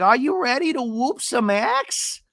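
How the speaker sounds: high, slow mocking echo; Gina. Imitating a housewife echoing the axe joke, high mock voice. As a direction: drag it out high without shouting. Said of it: "And Gina's like".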